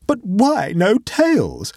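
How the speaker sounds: high, slow, bewildered question; drawn-out question with a touch of fear; fearful, exasperated. A narrator's reading. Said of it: "teeth were chattering with fear and rage".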